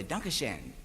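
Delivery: "Dankeschön" said low, soft and clipped the northern German way; mock northern German "Dankeschön", dropped low and quiet; northern German accent. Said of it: "they talk like New Yorkers".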